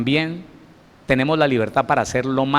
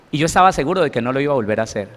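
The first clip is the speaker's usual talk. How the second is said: ordinary talk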